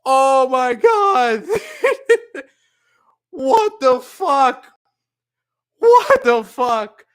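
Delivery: high, slow, drawn-out astonishment; real stunned disbelief. Real, not acted. Streamer reeling from the raid, high stunned drawl.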